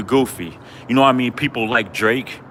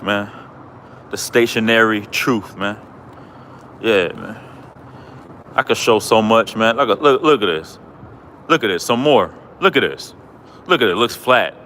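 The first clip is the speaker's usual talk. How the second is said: ordinary talk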